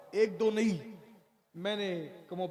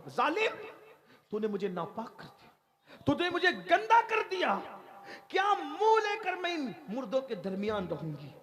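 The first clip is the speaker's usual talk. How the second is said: screaming accusation; 6 semitones higher than usual. Acted. Revived girl shrieks at the grave robber in outrage. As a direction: scream it at him in fury. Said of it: "उसने चीख मारी".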